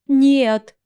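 sudden desperate scream of no. Acted. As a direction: cry out in despair. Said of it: "мир содрогнулся от моего крика".

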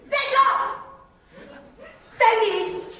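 high, loud indignant complaint; scandalised protest; indignant outrage. Acted. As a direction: call out the troublemaker.

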